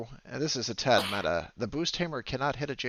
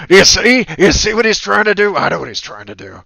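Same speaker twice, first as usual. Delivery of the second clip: loud, gloating villain aside; 6 semitones higher, 16 dB louder than usual. Acted. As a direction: shout it like a scheming villain. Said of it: "the villain in the background goes".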